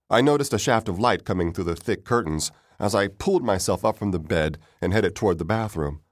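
usual speaking voice

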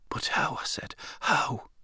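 quiet wondering plea; hushed. A narrator's reading. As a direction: ask almost under the breath. Boy asks in hushed wonder.

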